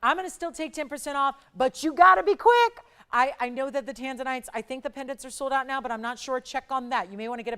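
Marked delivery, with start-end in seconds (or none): 1.6-2.8 urgent push. Real, not acted. Urgent sales pitch, calling out.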